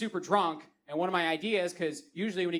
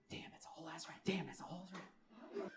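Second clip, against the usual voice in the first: hushed, whispered replay to yourself; whispered remembering; 18 dB quieter than usual. Acted. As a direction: whisper it over and over, savoring it. Replaying her words to himself.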